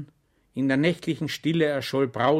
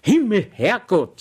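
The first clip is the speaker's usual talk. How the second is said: exasperated sigh, raised and slowed; the lieutenant sighs at Schwejk, exasperated; sighed curse; 9 semitones higher, 9 dB louder than usual. Acted. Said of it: "seufzte der Leutnant".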